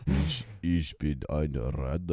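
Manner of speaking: low, slow Ich bin eine Ratte; drawn out Ich bin eine Ratte; German line. Shown as a demonstration. Co-host saying the German phrase again, slowly. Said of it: "Say that again?".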